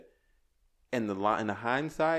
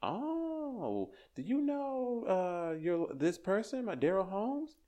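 put-on girl's excited voice; 10 semitones higher, much slower than usual. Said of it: "She was like".

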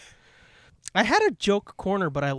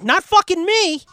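sudden much higher, louder shout; defiant outburst; 13 semitones higher, 9 dB louder than usual. Retold or imitated. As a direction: yell it like you refuse to be included. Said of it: "and it's like".

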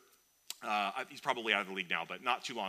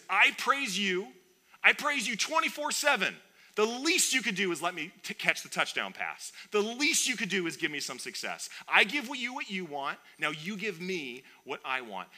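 high, loud, imitated complaint; mock indignant entitlement; 8 semitones higher, 6 dB louder than usual. Receiver demanding payback from God, mock indignant. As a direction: lay out the demand like an aggrieved bargainer.

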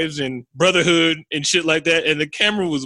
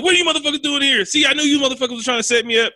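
higher and fast, accusing rant; paranoid outburst; 8 semitones higher, much faster than usual. Retold or imitated. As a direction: fire it off like a cornered paranoid man. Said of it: "on the other side of fully unraveling".

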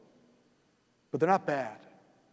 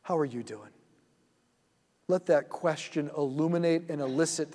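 soft, slow meditation voice, lower and quieter; guided meditation; 3 semitones lower, 3 dB quieter than usual. Acted. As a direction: lead them gently into the scene, unhurried.

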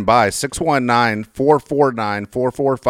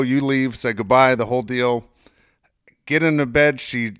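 normal talking voice

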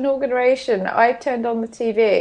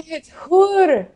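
attempted Scottish accent; 4 semitones higher, 3 dB louder, much slower than usual. Shown as a demonstration. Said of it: "You've gotta do it with a Scottish accent".

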